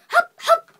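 loud, high hiccups; two sudden yelped hiccups; acted hiccups. A narrator's reading. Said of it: "he's making me hiccup".

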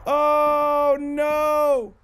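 loud, drawn-out dismay; dismayed wail. Real, not acted. Player dreading what the bloodstains mean, dismayed wail.

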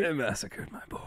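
soft, high mock lament; Godfather echo. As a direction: echo the grieving mob father under your breath. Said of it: "like in The Godfather".

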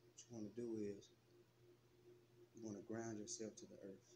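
soft meditation voice, long pauses between phrases; guided meditation. Acted. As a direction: guide them gently into relaxation, leaving space to breathe.

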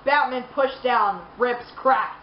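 ordinary talk, nothing marked

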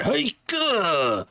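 mock anime character voice; overacted anime dub. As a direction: say it like an anime hero. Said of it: "You sound like an anime character".

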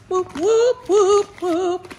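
high whooping cheer; cheering on the dog's new shoes; excited cheer. Real, not acted.

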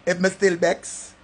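loud, high, heated ranting; vexed rant. Acted. Still vexed at Stacy, he rants loud and heated.

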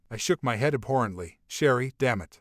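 plain reading voice

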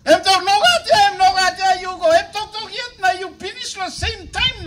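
loud, punchy preacher's bursts; full-force preaching. Acted. Pastor pressing the congregation, loud preaching. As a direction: hammer each short phrase out.

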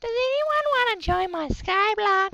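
high, slow sing-song question. Acted. Asking viewers to join his Skyblock, high and slow. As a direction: call it out in a silly high voice.

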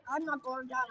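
normal talking voice

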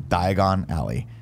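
"Diagon Alley" said slowly as two separate words; deliberate, slow "Diagon Alley"; separated words. Shown as a demonstration. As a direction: spell out "Diagon Alley" slowly, a clear break before "Alley". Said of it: "if you say it very fast".

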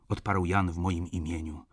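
plain reading voice

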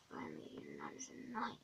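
very low, whispered aside; relieved whisper. Acted. Relieved student muttering after the joker leaves, whispered. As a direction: breathe it out under your breath.

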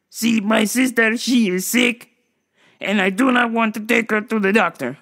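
stiff, deliberate caricature; Doctor Octopus. Retold or imitated. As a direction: imitate an oddball explaining his troubles. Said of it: "I talk like this because I'm Doctor Octopus".

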